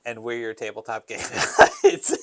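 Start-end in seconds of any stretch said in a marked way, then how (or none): 1.2-2.2 breaking into laughter. Real, not acted. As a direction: laugh cracks the last word. Breaks up mid-line.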